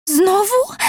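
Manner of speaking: very high, rising tearful question; acted sobbing. A narrator's reading. Fasolka in tears at another incident, an acted high sob. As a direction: wail it upward like a distressed girl. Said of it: "zaszlochała".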